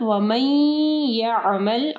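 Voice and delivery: वमन यामल with n melted into a nasal y; वमन यामल as a slow "wamayy-ya'mal"; idgham with ghunnah. Shown as a demonstration. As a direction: draw out a hum in the join of वमन यामल. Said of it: "गुन्ना के साथ".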